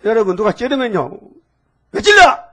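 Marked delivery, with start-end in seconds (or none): 1.9-2.4 much higher, louder indignant cry; mock angry yelp. Preacher acts out an immature believer, mock angry yelp. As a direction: squeal like someone pricked and offended. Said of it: "이렇게 하면요".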